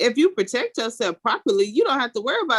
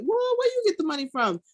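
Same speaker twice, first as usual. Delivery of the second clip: surprised; 8 semitones higher than usual. Retold or imitated. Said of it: "He like".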